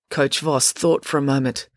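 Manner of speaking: ordinary delivery, nothing marked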